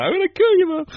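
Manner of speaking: high comic wrestler threat; mock threat. Wrestler answering a taunt, high mock threat. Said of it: "Sheik's like".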